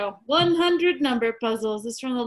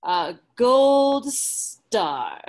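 mocking; 4 dB louder, much slower than usual. Mocking the chart.

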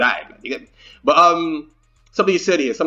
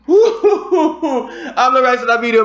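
laughing through the words; 5 semitones higher, 4 dB louder, slower than usual. Real, not acted. Reaction host laughing at the video's ending. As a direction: laugh out the line in disbelief.

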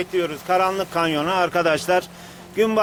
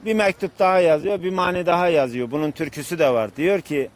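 ordinary talk, nothing marked

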